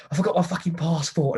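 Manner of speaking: high-pitched imitation of a cross child; indignant kid. Runaway kid back for his passport, mock indignant child. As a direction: play the punchline as an exasperated little boy.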